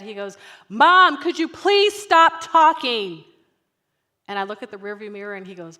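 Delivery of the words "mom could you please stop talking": put-on loud, slow, high-pitched kid outburst; exasperated child. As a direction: imitate a fed-up kid snapping at mom. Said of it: "this is what he said".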